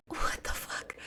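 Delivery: stunned breathy whisper; hushed disbelief under the breath. Retold or imitated. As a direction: mouth it in quiet shock.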